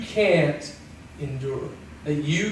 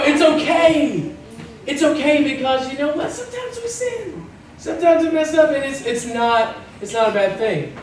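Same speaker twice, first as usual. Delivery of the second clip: mock self-excuse; 6 semitones higher than usual.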